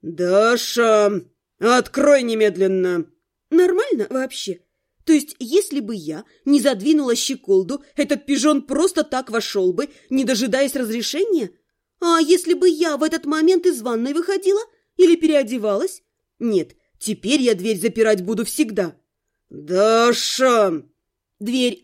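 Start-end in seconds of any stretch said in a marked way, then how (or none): 0.0-3.0 low, slow, menacing order; angry man demanding entry; threatening command. A narrator's reading. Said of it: "В его голосе была не просто угроза". 19.5-20.8 low, drawn-out name called in warning; menacing male character call; angry call. A narrator's reading. Angry man calling through the door.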